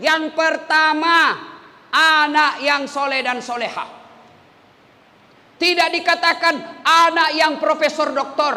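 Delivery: thundering preacher calling out to the congregation; fiery preaching. Acted. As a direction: preach it out to the whole crowd. Preaching at full force.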